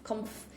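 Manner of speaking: "comf-" as one syllable with short u; sound demonstration. Shown as a demonstration. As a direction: say "comf-" as CUMF. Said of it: "it's almost like C U M F".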